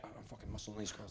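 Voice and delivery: fast, breathless whisper; secretive fast whisper; whispered aside. Retold or imitated. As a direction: hiss it under your breath, quickly. Muttered aside about pushing girls.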